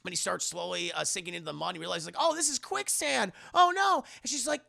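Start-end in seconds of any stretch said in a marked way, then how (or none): 2.2-4.0 very high, rising, lightly alarmed voice; mild mock panic. Jen notices he is sinking in quicksand, very high mock panic. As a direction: realize you're sinking, half-joking panic.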